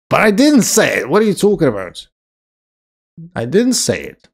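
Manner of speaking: high, loud, playful denial; indignant protest. Real, not acted. Streamer denying to chat that they said a slur, indignant protest.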